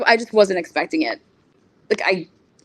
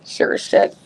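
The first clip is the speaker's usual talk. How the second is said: ordinary delivery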